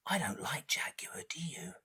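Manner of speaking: hushed near-whisper; very soft, conspiratorial; confiding aside. Acted. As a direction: whisper it secretly to the children.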